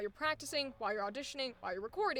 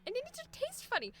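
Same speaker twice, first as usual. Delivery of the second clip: mocking voice; 9 semitones higher, faster than usual. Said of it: "We don't get to get away with just".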